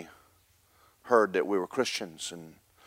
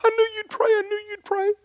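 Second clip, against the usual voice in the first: high squealing mock cry of a grateful mother; high mock wail of a relieved mother; imitating a crying mother. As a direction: pitch it way up like a sobbing woman. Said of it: "she's screaming and crying and going".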